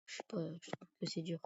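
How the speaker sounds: ordinary talk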